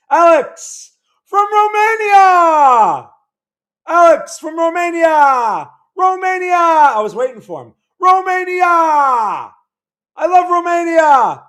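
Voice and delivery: high, drawn-out shouted greeting; yelling. Real, not acted. Streamer hails a viewer from Romania, yelling. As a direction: shout it out high, stretching the last word. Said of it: "I was just yelling at you".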